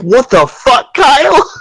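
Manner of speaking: high-pitched retold outcry; exasperated disbelief. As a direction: re-enact a baffled outburst, climbing on the name. Acts out discovering the faked result.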